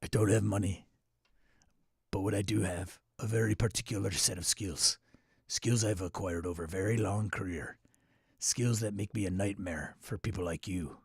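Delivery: low, measured threat; quiet, deliberate action-hero menace; Liam Neeson. Retold or imitated. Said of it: "That's my Liam Neeson".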